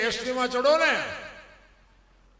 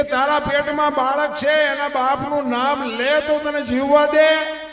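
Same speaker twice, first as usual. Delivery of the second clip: stern saint's question; 6 semitones higher, 8 dB louder than usual. Acted. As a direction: press her hard for the truth. Saint questions the pregnant girl.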